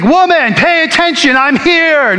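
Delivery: sharp, high mock demand for attention; forceful demand. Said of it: "Does he grab her and say".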